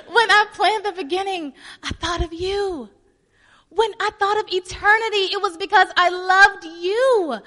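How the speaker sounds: loud, high, tearful-sounding plea; impassioned pleading. Acted. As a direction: plead your love with full force. Acts God's impassioned plea.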